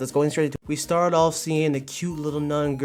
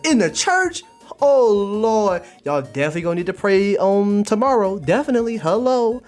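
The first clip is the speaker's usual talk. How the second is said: high, loud mock outrage; scandalized outcry; 7 semitones higher, 6 dB louder than usual. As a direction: cry out in comic disbelief, drawing words out. Mock shock at pentagram in church.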